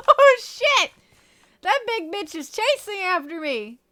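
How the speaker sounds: high, loud panicked screaming; scared horror game streamer. Real, not acted. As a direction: shriek while fleeing in panic. Emu chasing player in game.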